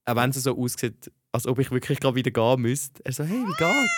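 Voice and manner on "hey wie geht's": higher-pitched imitation of a greeting; lightly mock the eager officemate; chatty colleague. Colleague greeting her as she walks in, chatty imitation.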